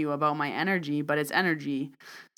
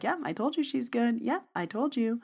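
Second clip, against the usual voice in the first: higher-pitched friend voice; imitated vouching friend; 7 semitones higher than usual. Friend sitting there vouching for the reader, higher voice. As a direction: imitate a friend backing you up.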